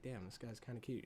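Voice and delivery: low, soft private admission; hushed crush. Retold or imitated. Tess warming to Keith, soft murmur. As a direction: murmur it to yourself, a bit charmed. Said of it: "She's like".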